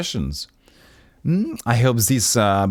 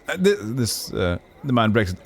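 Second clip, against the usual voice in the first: usual speaking voice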